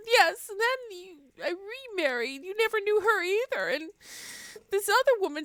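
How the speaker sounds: high, hammed-up weeping voice of a grieving neighbor; a neighbor crying over a tree, mock sobbing high voice. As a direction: squeeze out a tearful high wail as the bereaved man. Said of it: "He's just standing there sobbing".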